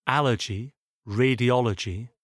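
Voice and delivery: "allergy, radiology", each stressed third syllable from the end; antepenultimate-syllable stress. Shown as a demonstration. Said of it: "sont accentués sur l'avant-avant-dernière syllabe".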